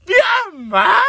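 grito lloroso alargado; llanto imitado. Retold or imitated. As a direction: imita a las hermanas berreando por mamá. Las hermanas ahora lloran gritando. (berrido actuado llamando a mamá)

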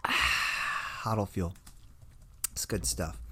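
slow savoring breath after a sip; whispered, savoring the coffee; slurping coffee tasting. Pretend. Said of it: "my little shtick I do with the coffee".

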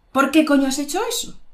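quick, high angry question, rising. Shown as a demonstration. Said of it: "Estoy enfadada".

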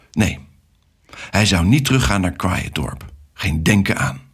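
plain reading voice

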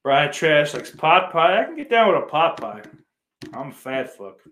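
ordinary talk, nothing marked

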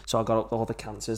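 normal talking voice

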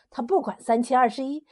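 ordinary delivery, nothing marked

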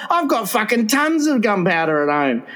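slow, high, pushy bloke; brash blokey voice. Retold or imitated. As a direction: play the reckless know-it-all mate. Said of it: "he goes".

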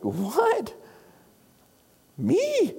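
a slow "what", then a very high "me"; mock disbelief. Hearers told they are the world's light, high mock disbelief. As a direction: squeak out "me" in disbelief. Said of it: "We're like".

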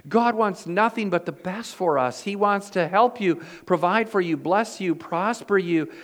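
full-voiced sermon climax; preaching. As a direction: drive the point home to the congregation.

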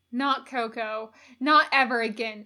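ordinary delivery, nothing marked

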